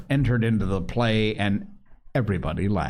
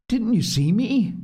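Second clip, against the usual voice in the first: eager pleading; 4 dB louder than usual. Acted. Boy pleading for witnesses.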